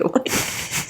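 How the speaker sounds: unvoiced chuckle; silent breathy laugh. Real, not acted. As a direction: laugh quietly under the breath. Laughs at her own joke.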